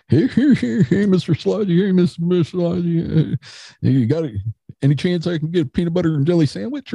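high, fast, silly cartoonish begging voice; goofy buddy voice. Buddy Kevin begging his friend for a sandwich, high and goofy. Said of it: "He's like".

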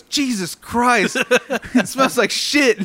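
slow, high and loud, breaking into laughter; mock an appalled grandpa, laughing through it; imitated disgusted outburst. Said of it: "he's yelling at his grandson".

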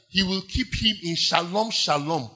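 shalom said the Hebrew way, sha-LOM with a nasal o. Shown as a demonstration. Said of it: "actual translation of that word is".

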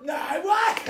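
high startled "no, what?"; surprised shout. Real, not acted. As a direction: shout it in disbelief. Surprised shout at guest's remark.

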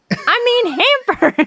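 high-pitched mock yell of a correction; retold yell. Wife imitating husband correcting his slip, mock yell. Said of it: "so you're yelling at her".

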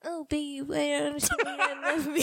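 high, drawn-out whiny imitation; mock whining. Wife mimicking husband's pleas for love, mock whining. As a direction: whine like a needy husband, laughing at it. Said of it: "Like, 'cause you whine. Can you do the voice".

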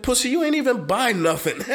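high, scornful retold jab; mocking taunt. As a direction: sneer it at him. Mocking drunk customer.

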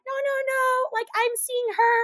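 high-pitched mock pleading; squeaky acted protest; flustered boy denying. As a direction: imitate a boy scrambling to explain, voice high. Imitating boy's panicked denial.

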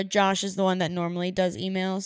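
normal talking voice

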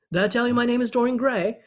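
high, quick mock British vampire boast; Russell Brand impression. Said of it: "Russell Brand impression".

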